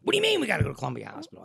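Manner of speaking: very fast, incredulous comeback; startled wife. Retold or imitated. Wife questioning husband about the hospital, startled. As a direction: fire the question straight back, mildly imitated alarm.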